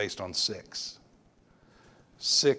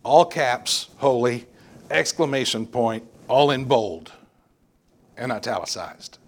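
emphatic, all caps; 3 semitones higher, 8 dB louder than usual. Shown as a demonstration. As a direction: punch every word like bold capitals. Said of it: "What we mean with that is".

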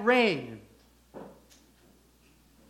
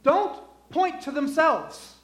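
louder, higher, driving the point home; full-force preaching; 7 semitones higher, 7 dB louder than usual. Acted. Pastor to congregation, preaching at full force.

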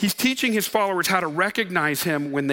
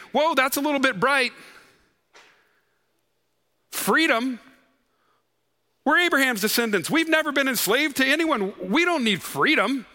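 mock-incredulous, pitched up, brushing it off; dismissive; 6 semitones higher than usual. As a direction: play the crowd waving the offer away.

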